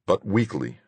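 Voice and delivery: ordinary delivery, nothing marked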